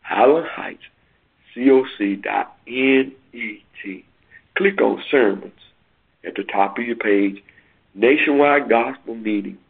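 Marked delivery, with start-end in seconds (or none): 0.0-4.1 said slowly. Shown as a demonstration. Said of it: "Let me say that slowly".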